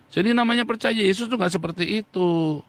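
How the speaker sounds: ordinary talk, nothing marked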